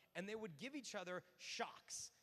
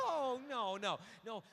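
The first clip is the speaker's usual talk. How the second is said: loud, higher run of refusals; mock frustration; 4 semitones higher, 11 dB louder, slower than usual. Woman rejecting her man's answer, mock frustration. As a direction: cut him off in hammed-up exasperation.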